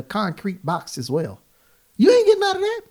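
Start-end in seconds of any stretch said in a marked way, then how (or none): 2.0-2.8 emphatic high exclaim. Real, not acted. Emphatic about concrete box.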